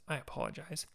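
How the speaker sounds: normal talking voice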